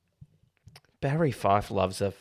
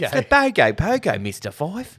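high, loud mock Aussie film dialogue; hammed-up Australian accent, pitch leaping up; mimicked Aussie accent; 8 semitones higher, 4 dB louder than usual. Host acts out the Bogo Pogo exchange, hammed-up Aussie accent. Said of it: "The way they say it".

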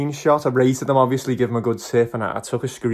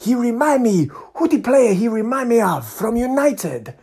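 put-on high, excited Nigerian accent; soaring, drawn-out Nigerian-accented comparison; imitated Nigerian fan; 10 semitones higher than usual. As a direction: build to the name in a Nigerian accent. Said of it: "I'm trying not to cry".

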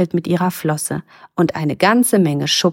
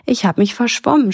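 put-on higher-pitched, mildly tearful child; sobbing; 6 semitones higher than usual. A narrator's reading. Lost merboy confesses to Mima, mildly sobbing. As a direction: whimper it, pitched up. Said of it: "schluchzt Matti".

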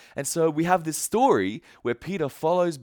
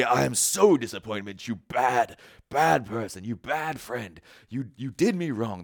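mock scolding; 3 semitones lower than usual. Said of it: "He's not here to say".

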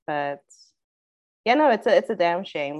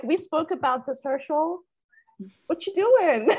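high-pitched imitation of a reproachful moderator; mock scolding; 8 semitones higher than usual. Moderator telling off a candidate over his prop, mock scolding. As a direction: scold him like a let-down chaperone, pitched up.

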